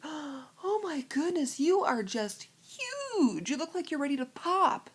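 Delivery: high, drawn-out gushing exclamation; high-pitched mock amazement; mock astonishment. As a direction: imitate an over-amazed busybody, stretching the words. Imitates gushing stranger in the store.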